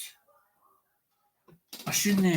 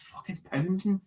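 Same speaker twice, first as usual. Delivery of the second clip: hushed, half-whispered complaint; retold complaint; 3 semitones higher, 4 dB quieter than usual. Man telling a friend his head is pounding, hushed. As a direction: breathe it out like your head hurts. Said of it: "I says".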